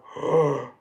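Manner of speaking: loud, sudden gasp; exaggerated intake of breath; mock gasp. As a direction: gasp as if imitating someone startled. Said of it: "that makes them go".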